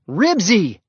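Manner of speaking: high, loud shouted name. A narrator's reading. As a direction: shout for your dog. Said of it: "shouted Henry".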